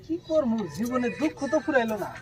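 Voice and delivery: soft, downhearted complaint; acted lament. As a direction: bemoan your luck under your breath. Acted scene, lamenting endless sorrow.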